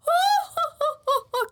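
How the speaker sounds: very high haughty cartoon laugh; hammed-up lady's laugh; villainess laugh. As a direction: trill out a showy high "ho ho ho". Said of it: "she's like the".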